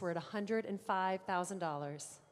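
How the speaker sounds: usual speaking voice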